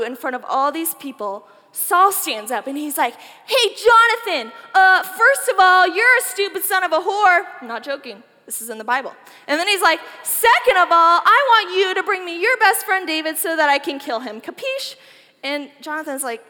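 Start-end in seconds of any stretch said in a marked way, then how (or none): 3.5-7.5 raised, higher, louder rage at his son; furious king. Acted. King Saul snaps at Jonathan before the dinner guests, loud and furious. 10.2-14.9 raised, higher, louder rage at his son; furious king. Acted. King Saul snaps at Jonathan before the dinner guests, loud and furious. Saul orders David brought to him.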